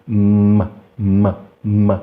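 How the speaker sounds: "m" curto, empurrado para fora; som isolado do M. Shown as a demonstration. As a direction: solte um "m" sozinho, repetido. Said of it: "o som da letra M". (som de "m" isolado, repetido)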